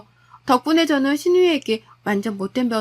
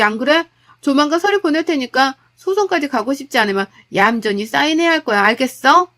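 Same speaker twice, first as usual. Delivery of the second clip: louder, cold ultimatum; wife telling husband they're divorcing, furious; 4 dB louder than usual. Acted. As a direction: declare the divorce, icy and loud.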